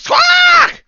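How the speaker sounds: very high, loud bird squawk; startled squawk. Acted. Burly man tapped on the shoulder, piercing squawk. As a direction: squawk like a parrot snapping round. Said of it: "snaps over to you and goes".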